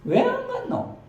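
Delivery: shrieking-high Gyeongsang granny asking why; a startled old country woman; Gyeongsang grandma. Acted. Grandma demands why she skipped the funeral, in very high Gyeongsang dialect. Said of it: "경상도 버전으로".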